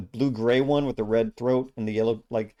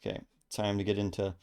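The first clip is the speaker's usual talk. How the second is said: ordinary delivery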